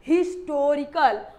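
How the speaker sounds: historical said slowly with its h; sounded h in historical. Shown as a demonstration. Teacher answering with the modern reading, historical with h. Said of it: "क्या पढ़ने लगे आधुनिक नाम में".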